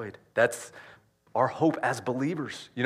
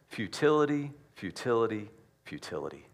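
trailing off to a hush; fading repetition; 2 semitones lower, 5 dB quieter than usual. Acted. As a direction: let the word die away three times. Repeated word dying away.

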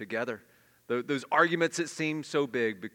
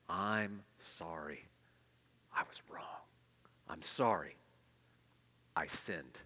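slow, low and whispered; humble apology; 9 semitones lower, 8 dB quieter, slower than usual. Shown as a demonstration. A person humbly confessing a wrong, whispered apology. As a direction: breathe it out in humble contrition.

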